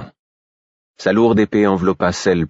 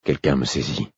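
usual speaking voice

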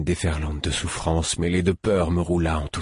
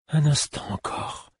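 slow, high-pitched supplication; pleading; 8 semitones higher than usual. A narrator's reading. Fool begging his patient to endure the treatment, pleading. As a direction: plead in a raised, drawn-out voice.

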